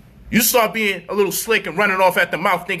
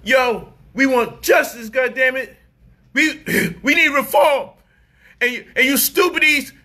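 mock protester; 6 semitones higher than usual. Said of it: "Negro is on his knee".

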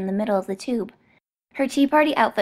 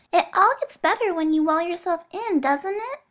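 put-on little girl voice; 8 semitones higher than usual. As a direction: call him along like a child. Bonesaw soothing.

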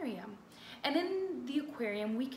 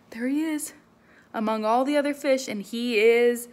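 dramatic reveal; 2 semitones higher, 12 dB louder than usual. Acted. Teacher dramatizes the picture.

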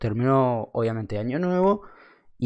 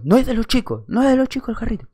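high-pitched, insistent mock whine; put-on voice of a stubborn drunk; imitated bratty child; 9 semitones higher than usual. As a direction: protest like a stubborn little kid. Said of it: "parecía un nene caprichoso".

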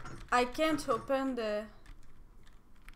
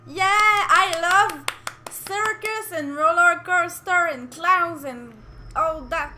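exhilarated; 5 semitones higher, 9 dB louder than usual. Real, not acted. Player on the game's roller coaster, exhilarated and loud. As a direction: sing it out with delight.

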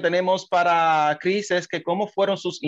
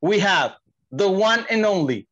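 lucha libre announcer; 2 semitones higher than usual. Acted. Announces the guest's name.

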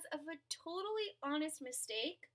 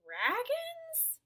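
puzzled high question; 12 semitones higher than usual. Acted.

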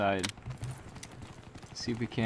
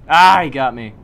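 angry outburst; 3 semitones higher, 17 dB louder than usual. Real, not acted. Gets killed by enemy.

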